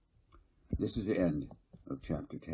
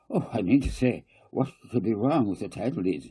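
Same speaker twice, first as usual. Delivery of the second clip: anxious alarm; 5 semitones higher, 5 dB louder than usual. A narrator's reading. Kading alarmed.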